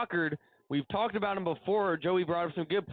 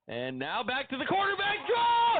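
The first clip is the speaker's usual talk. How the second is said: roaring touchdown call; 10 semitones higher than usual. Real, not acted. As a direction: scream the touchdown home with joy. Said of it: "That was a roar".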